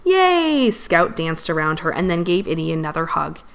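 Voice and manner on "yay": high, drawn-out cheer; jubilant, pitched way up; excited cheer. A narrator's reading. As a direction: let out a gleeful high whoop. Scout celebrates with a dance.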